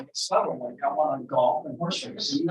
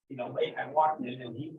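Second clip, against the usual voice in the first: normal talking voice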